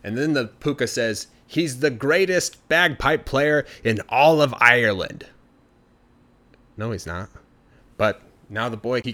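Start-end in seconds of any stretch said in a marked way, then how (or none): 1.4-5.3 loud, slow, showy announcer voice; Pooka's grand boast. Acted. The Pooka loudly boasts to the banshees about the boy's piping. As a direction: sell him like a carnival barker.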